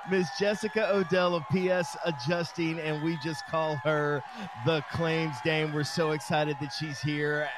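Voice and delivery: loud, slow hype introduction; ring announcer. Acted. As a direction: announce them like fighters. Announces co-hosts like boxers.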